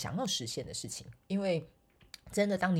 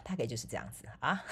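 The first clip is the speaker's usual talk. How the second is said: normal talking voice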